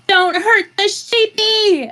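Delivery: high-pitched pleading yell; in-character yell. Acted. Druid yells at the orcs to spare the sheep, high yell. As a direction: cry out high, drawing it out. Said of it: "And I will yell".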